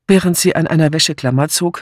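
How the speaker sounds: plain reading voice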